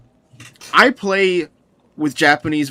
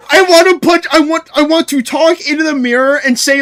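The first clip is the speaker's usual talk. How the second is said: higher, louder exasperated rant; comic frustration; 8 semitones higher, 6 dB louder than usual. Real, not acted. Streamer ranting about his own habit, higher louder exasperation. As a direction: blow up at yourself comically.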